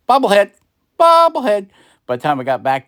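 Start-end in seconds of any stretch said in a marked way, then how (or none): 0.1-0.5 P=A; high silly voice. Pretend. Playful silly repeat. 1.0-1.7 bobblehead squeaked much higher; squeaky very high voice. Pretend. Playful silly repeat.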